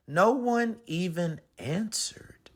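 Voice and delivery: hushed, breathy whisper; whispered aside. Acted. Narrator whispering to listeners, hushed and glum. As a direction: whisper it like a sulky secret. Narrator sulks, no one answers.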